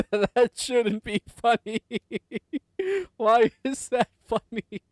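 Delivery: words dissolving into high-pitched laughter; trying to object while cracking up; helpless laughing. Real, not acted. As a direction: protest through real giggles. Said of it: "Why am I laughing so much?".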